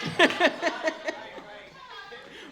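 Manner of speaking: real laugh. Real, not acted. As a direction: burst of high, delighted laughter. Amused by questioner's joke.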